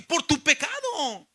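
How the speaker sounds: ordinary talk, nothing marked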